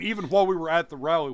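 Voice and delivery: ordinary talk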